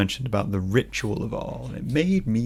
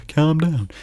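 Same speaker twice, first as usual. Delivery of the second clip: loud, higher, patronizing "Calm down"; dismissive calm down; 6 semitones higher, 5 dB louder than usual. Retold or imitated. Mocking someone who tells an angry person to calm down, loudly and patronizingly. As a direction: snap "Calm down" the way nobody wants to hear it.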